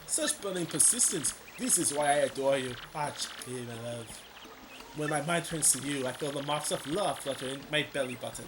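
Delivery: hammed-up French accent, crooning; a hammy French charmer; mock French flirt. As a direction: sweet-talk her in a fake French accent. Dupree flirts again.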